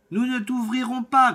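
put-on high, loud children's cry; kids shouting. Goat kids shouting at the wolf through the door, high and loud. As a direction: shout it out like frightened little goats. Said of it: "crièrent-ils".

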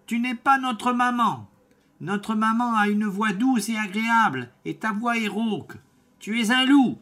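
put-on high, loud children's cry; kids shouting. Goat kids shouting at the wolf through the door, high and loud. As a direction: shout it out like frightened little goats. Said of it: "crièrent-ils".

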